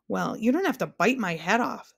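plain reading voice

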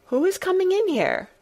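put-on high, startled boy's voice; quick startled boy. A narrator's reading. Colin startled by a stranger at the door, high startled voice. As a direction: blurt it out, startled. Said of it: "he said quickly".

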